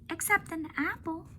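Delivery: high, innocent little-elephant voice, puzzled; young elephant Ruby. Acted. Ruby asking Ivan about the carrots, high and puzzled. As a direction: play Ruby as a small, curious child. Ruby's voice in the read-aloud.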